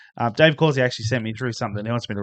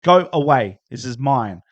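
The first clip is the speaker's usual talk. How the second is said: put-on shooing kids away; 3 semitones higher, 8 dB louder than usual. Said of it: "she's like".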